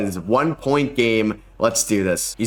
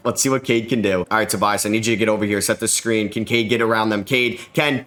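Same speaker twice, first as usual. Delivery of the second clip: ordinary delivery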